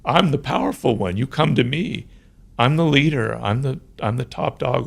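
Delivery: lightly voiced boss, softly self-assured; powerful boss. Boss leaning back before nervous employees, lightly voiced powerful boss. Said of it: "the boss kind of leaning back".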